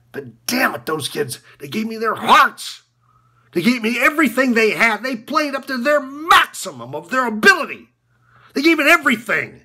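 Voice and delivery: building to a high, forceful cry; heated, rising, pushed voice; impassioned outburst. Acted. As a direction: let it swell with fierce pride in them. Impassioned tribute to his players.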